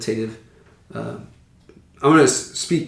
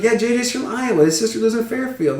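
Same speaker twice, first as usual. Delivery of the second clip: neighbors quoted; 10 semitones higher than usual. Retold or imitated. Neighbors quoted, high pitched.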